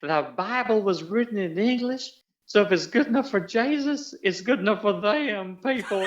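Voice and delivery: high, twangy Southern lady on TV; Southern twang. Retold or imitated. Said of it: "she taught me how to say the dialogue".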